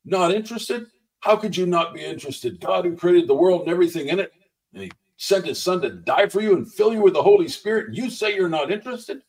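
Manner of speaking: high, incredulous challenge; retold boldness. Minister imitates little lady confronting the biker, suddenly bold. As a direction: imitate a bold rebuke in rising, insistent questions. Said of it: "this boldness hit her".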